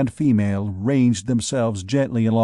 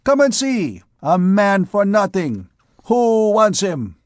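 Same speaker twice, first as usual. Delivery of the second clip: high, loud crier's call; 8 semitones higher, 3 dB louder than usual. Acted. Rough beggar king calling the wenches to bid. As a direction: call the crowd like a hawker. Auctioneer offering Gringoire to women.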